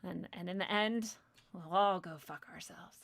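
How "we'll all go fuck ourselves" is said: hushed deadpan aside; whispered joke. Real, not acted. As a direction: whisper the punchline. Whispered punchline.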